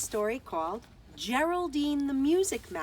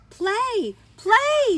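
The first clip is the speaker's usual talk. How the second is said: high, loud pleading play; little mouse crying out to the silent flute, begging; 12 semitones higher, 10 dB louder than usual. A narrator's reading. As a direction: beg the flute to keep going. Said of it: "Geraldine begged".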